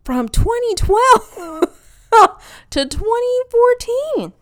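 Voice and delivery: very high, loud, slow boast; hammed-up interview lie. Candidate bragging of invented brother's championship, hammed-up cheer. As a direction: stretch the dates out in a squeaky fake voice. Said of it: "I be lying".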